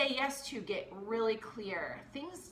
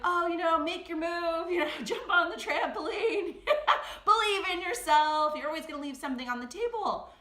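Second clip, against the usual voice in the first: higher, louder mock cheerleading through laughter; pushy hype voice; 5 semitones higher, 6 dB louder than usual. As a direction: hype her up like an overexcited friend. Friends pushing her to decide.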